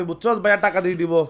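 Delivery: ordinary talk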